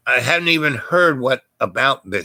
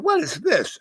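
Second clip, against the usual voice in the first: much higher bewildered what is this; puzzled question; 3 dB quieter than usual. Retold or imitated. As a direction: ask it baffled, pitch jumping up. Bewildered at mark on hand.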